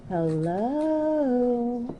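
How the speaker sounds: high lilting sing-song hello. Retold or imitated. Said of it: "It sounded like, / in a sing-song voice".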